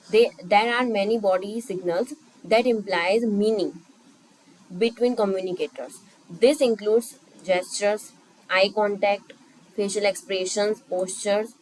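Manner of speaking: reads each word slowly for notes; slow dictation. Shown as a demonstration. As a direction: dictate it so students can write. Teacher dictates English definition slowly. (धीरे-धीरे लिखवाने वाला अंदाज़)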